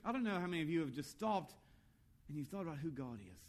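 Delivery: ordinary delivery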